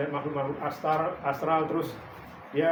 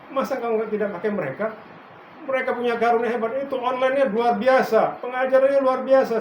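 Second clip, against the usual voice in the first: higher, louder, quick praise of others; eager protest; 6 semitones higher, 7 dB louder than usual. Retold or imitated. The pastor protests to God, louder and eager. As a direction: gush about their gifts, a bit fast. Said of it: "saya bilang".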